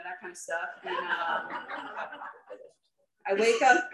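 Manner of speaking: usual speaking voice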